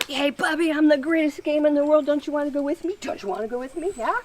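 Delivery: high, bright coaxing call to a puppy; excited puppy talk. Shown as a demonstration. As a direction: sell yourself as the greatest game. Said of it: "it's me adding energy".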